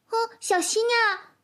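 squeaky little warning; high warning voice. Acted. Warning as child takes ladle.

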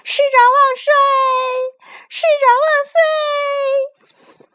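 stretched-out cheering yell; excited cheering. Acted. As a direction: roar it like a jubilant crowd. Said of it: "纷纷激动地挥着手大叫".

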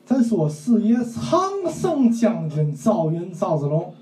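slow, emphatic mock storyteller in Tianjin dialect; 天津话评书腔; Tianjin-dialect storytelling. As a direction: drag out the phrases like a Tianjin storyteller. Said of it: "那你给学学".

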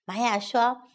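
ordinary delivery

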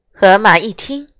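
ordinary delivery, nothing marked

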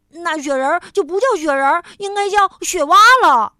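loud, fast, rising in anger. A narrator's reading. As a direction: raise your voice to win the argument. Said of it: "蹦蹦提高了嗓门儿".

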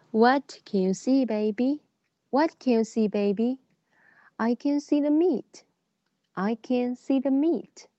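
slow, clear sing-song reading; reading to a toddler. Acted. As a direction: read it out to a small child. Reads English model lines to children.

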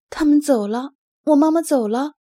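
loud, high, desperate wail; puppy realizing his mother is gone, loud despairing cry. Acted. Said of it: "纳努克绝望地大喊".

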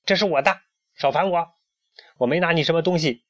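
high, quick, pushed protest; indignant denial. A narrator's reading. Hen denies stealing from the pharaoh, indignant.

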